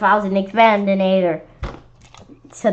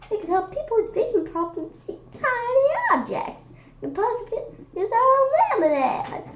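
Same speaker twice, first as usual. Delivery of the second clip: much higher, softer idealistic scientist voice; inventor's dreamy voice; 13 semitones higher, 3 dB quieter than usual. Acted. Miss Yonkers dreaming aloud to the kids, high and soft. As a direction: lift the voice high and gentle like a kind inventor. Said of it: "Oh, I don't care about prizes".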